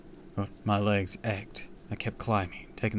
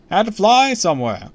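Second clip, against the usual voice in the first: loud, high showman; hammy celebrity charm; TV host voice; 11 semitones higher, 12 dB louder than usual. Acted.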